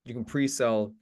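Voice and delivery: ordinary talk, nothing marked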